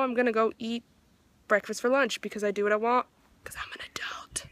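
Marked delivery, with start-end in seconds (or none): 3.4-4.5 whisper. Real, not acted. Joking to dog.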